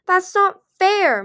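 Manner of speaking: high, loud, drawn-out protest; aggrieved cry. A narrator's reading. Mighty Claws jealous of Moon's powers, crying out. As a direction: wail the unfairness slowly and loudly. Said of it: "Mighty Claws cried".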